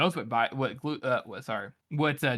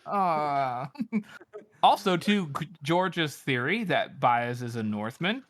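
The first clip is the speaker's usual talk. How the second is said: ordinary talk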